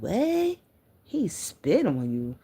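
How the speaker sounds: high, slow shocked question; shocked disbelief. Retold or imitated. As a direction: gasp it out in disbelief. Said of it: "I said".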